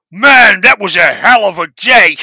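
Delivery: loud New York accent, voicing an actress after work; mock tough New York accent. Imagining Sofia Vergara at home, dropping into a New York accent. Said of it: "they talking with that accent".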